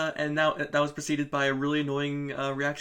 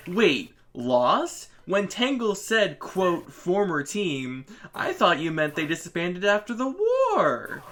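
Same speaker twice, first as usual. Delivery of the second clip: louder, exaggerated disbelief; incredulous mock outburst; 4 semitones higher, 7 dB louder, slower than usual. Streamer mocking Lanolin's comic dialogue, incredulous and loud. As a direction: read the line as loud, baffled protest.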